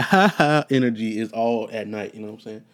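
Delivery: normal talking voice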